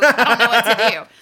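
loud burst of laughing bewilderment; loud, laughed-out mock dilemma; laughing helplessly. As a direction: crack up while acting out being at a loss. Retold dilemma, cracking up.

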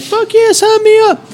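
put-on high, squeaky old lady voice; eager old lady. Old lady on the cruise volunteering, high eager mock voice. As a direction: play a thrilled grandma volunteering. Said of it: "old lady ... who's like".

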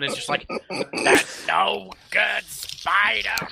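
drawn-out, very slow dying words; dying villain. Acted. Villain bleeding on the floor, dying slowly. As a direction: drag each word out as if bleeding out. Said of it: "he lies there bleeding on the floor".

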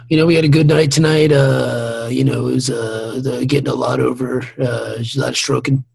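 mock post-game athlete, halting and low-key; ballplayer interview. Backstage after a night's work, mock ballplayer interview. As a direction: trot out tired sports clichés in a subdued, hesitant delivery. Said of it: "they look like Major League Baseball players".

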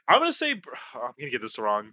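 usual speaking voice

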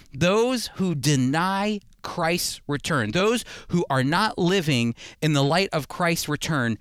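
ordinary talk, nothing marked

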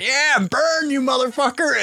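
high, gleeful cheer at the fire; cheering goofy partner. Retold or imitated. Host voicing the partner cheering the burning shed, high glee. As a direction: whoop like a goofy cop watching a shed burn. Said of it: "He's like".

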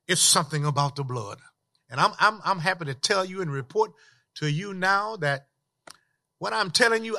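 ordinary delivery

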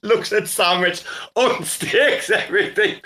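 high, slow, cracking up at the line; laughing repeat. Real, not acted. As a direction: draw it out high through laughter.

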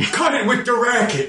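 high grumpy guard voice; annoyed guard. Acted. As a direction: grumble at the noise in a squeaky voice. Voicing a guard.

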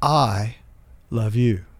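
"I" set apart by a pause before "love you"; first way of saying it. Shown as a demonstration. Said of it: "I can say them in three different ways".

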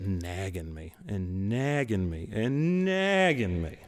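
each "nagging" stretched longer, the last drawn way out; relentless nagging. Retold or imitated. Husband describing his wife's persistence, "nagging" comically elongated.

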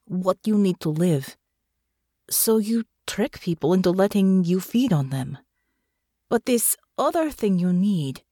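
earnest foreign character voice with careful phrasing; earnest Natasha. Acted. Natasha explains trust to Russo in bed, earnest character voice. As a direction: reason with her, pausing between phrases.

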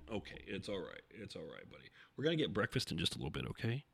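very soft reassurance to a dog; hushed, tender murmur; soothing a pet. Real, not acted. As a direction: comfort a sheepish pet quietly.

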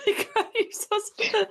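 high, giggly laughter through the words; laughing. Real, not acted. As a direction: laugh while gushing over her. Host moved, laughing.

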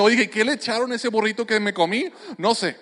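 ordinary talk

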